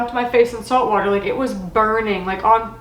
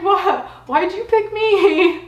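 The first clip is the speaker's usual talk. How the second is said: laughing through tears; 8 semitones higher, slower than usual. Retold or imitated. Quoting her own tearful reaction.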